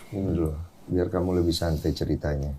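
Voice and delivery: a quiet caring aside to the guest; soft aside. Real, not acted. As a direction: murmur it kindly so she can relax. Host gently urging guest to drink.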